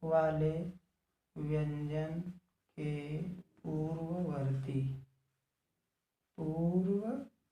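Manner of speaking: word by word with gaps; unhurried, separated words. Real, not acted. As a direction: dictate each word separately for note-takers. Repeats it word by word.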